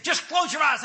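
high mock-preacher order; insistent preacher impression. Pastor to annoyed man before prayer, insistent high order. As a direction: press him like a zealous pastor.

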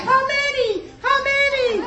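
put-on squeaky scared kids begging; kids pleading. Mother imitating her kids begging before a whipping. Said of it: "they'd be like".

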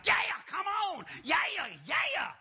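high, loud hollering, hammed up; wildly excited preacher. Short pastor rallying people forward, excited shouting. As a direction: mimic a fired-up pastor's shout. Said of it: "This other guy was like".

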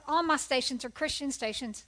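ordinary delivery

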